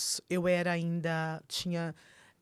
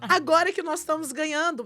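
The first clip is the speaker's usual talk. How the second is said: loud, high, far more intense rebuke in a preacher's style; fervent rebuke; 9 semitones higher, 7 dB louder than usual. Retold or imitated. Wife rebuking her husband's prophecy, loud fervent shout. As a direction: shout it down like casting out a curse.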